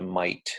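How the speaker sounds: mate said like 'might'; Australian-style might for mate; broad Aussie mate. Shown as a demonstration. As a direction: open the vowel of mate toward 'might'. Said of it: "Sometimes it's closer to might".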